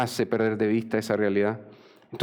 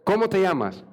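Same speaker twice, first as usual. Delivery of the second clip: insistent question, pitched up then falling; stern demand; 7 semitones higher than usual. Acted. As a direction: press for an answer. God repeats the demand to Jacob. (pregunta insistente, en tono alto)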